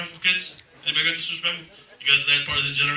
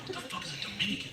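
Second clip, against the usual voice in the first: whispered question; 4 semitones higher, 14 dB quieter, much faster than usual. Retold or imitated.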